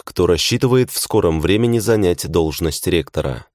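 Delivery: ordinary delivery, nothing marked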